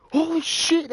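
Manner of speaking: loud, high-pitched re-enacted exclamation; retold excited outburst. Fan retelling a game-winning field goal, excited outburst. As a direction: relive the shock at full volume.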